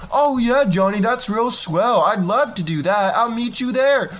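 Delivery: high, sweet mock girl voice; delighted girl. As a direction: gush like a happy teen saying yes. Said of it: "Susie accepting the date".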